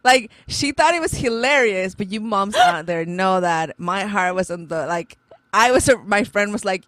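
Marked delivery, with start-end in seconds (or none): none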